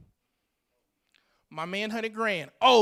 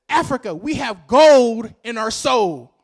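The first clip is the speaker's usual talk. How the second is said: slow rallying shout; 10 dB louder, slower than usual. Real, not acted. Speaker rallies the audience.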